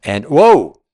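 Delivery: sudden loud, high startled cry; startled whoa. Real, not acted. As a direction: jump at a bird swooping past. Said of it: "that scared the heck out of me".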